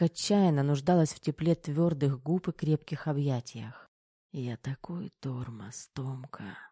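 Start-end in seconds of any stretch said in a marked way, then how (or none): 4.3-6.6 slow, very soft murmur; faint, dragging whisper; barely audible whisper. A narrator's reading. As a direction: whisper it slowly, with little strength. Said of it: "едва слышно шептал Кирилл".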